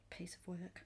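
breathy whisper of contempt; whispered disgust. Real, not acted. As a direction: hiss it under your breath. Disgust at villain.

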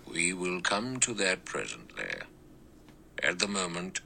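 softly, firmly cutting him off; quiet. A narrator's reading. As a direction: hush him with quiet authority. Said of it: "The judge said quietly".

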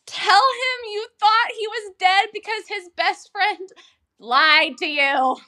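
high, slow, pleading call to the screen; exasperated plea. Retold or imitated. Urging the heroine at the screen, exasperated and high. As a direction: beg her to tell him, drawn out. Yelling advice at the character.

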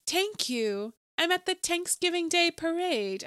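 "Thank" and "Thanksgiving" with a hard T, as "tank". Retold or imitated. Mocking old film actors, "Thank" said as "tank". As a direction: drop the H from "Thank" and "Thanksgiving", mock old-movie speech. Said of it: "people talk without the H in the T H words".

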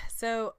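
mock exasperated sigh before the word; fake annoyed sigh. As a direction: sigh as if fed up, but playful. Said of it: "I sighed like I'm annoyed".

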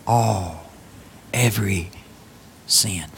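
"All. Every sin." slow, soft and weighty; each word set apart, softly; stress on all. Shown as a demonstration. Preacher pressing the point, soft and slow. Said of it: "Emphasis on the word all".

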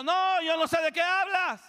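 high, loud frantic denial; denying shout. Retold or imitated. Peter denying he knew Jesus, shouting high. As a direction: deny it at the top of your voice. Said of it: "como mismo Pedro".

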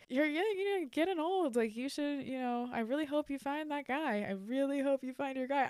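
high, exaggerated worried-relative imitation; mock doubtful concern. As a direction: parody him fretting that it won't happen. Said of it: "you're saying it like it's not gonna happen".

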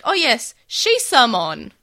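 loud, drawn-out "Shisamon"; hammed-up dub delivery, "Shisamon" stretched loud; mock reveal. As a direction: announce "Shisamon" slowly with exaggerated weight. Imitates the dub's blunt reveal.

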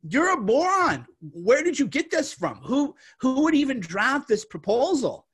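indignant mock rebuttal; imagined angry retort. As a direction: tell the drafters off, scornfully. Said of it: "you just think".

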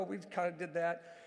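ordinary talk

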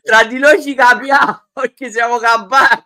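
giggling through the words; reading it while cracking up; laughing. Real, not acted. Laughs while reading fan comment.

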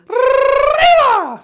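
long, loud, high-pitched mariachi yell; drawn-out festive grito cry. Shown as a demonstration. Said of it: "mariachi yell, or best known as a, a grito".

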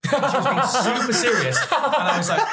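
real laughter over a teasing grudge; laughing. Real, not acted. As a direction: laugh through the mock I hate you. Laughing retelling of mock grudge.

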